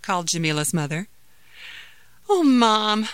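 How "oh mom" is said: whiny protest to mom, higher and louder; complaining. Acted. Daughter complaining to her mother about homework, complaining. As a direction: complain like a put-upon kid. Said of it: "complained Jamila".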